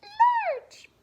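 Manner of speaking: very high, loud squeak; mouse voice. Shown as a demonstration. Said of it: "read it in your best mouse voice".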